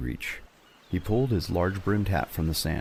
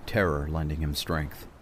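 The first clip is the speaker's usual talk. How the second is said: ordinary delivery, nothing marked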